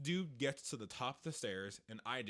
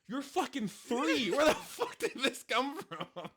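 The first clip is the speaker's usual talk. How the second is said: high-pitched, drawn-out disbelief breaking into laughter; amused incredulity; 10 semitones higher, 4 dB louder than usual. Retold or imitated. Dad baffled by his three-year-old's advice, incredulous. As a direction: marvel in comic exasperation.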